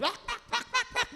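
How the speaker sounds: squealed high gibberish rant; mock ranting. Pastor mimicking his own rant at God, high and shrill. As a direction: sputter in mock fury.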